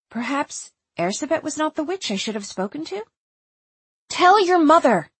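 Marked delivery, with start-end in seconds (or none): 4.1-5.1 high, loud, pressing plea; urgent. A narrator's reading. Narrator calls to Erzsébet being led away, urgent. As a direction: push it out sharply with urgency. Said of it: "I said urgently to Erzsébet".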